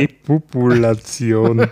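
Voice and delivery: drawn out, savoring each syllable; amused slow echo. Real, not acted. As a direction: stretch the word out slowly, amused. Amused echo of odd word.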